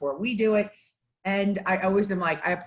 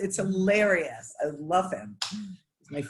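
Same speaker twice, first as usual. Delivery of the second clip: ordinary talk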